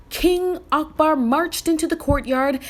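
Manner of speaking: usual speaking voice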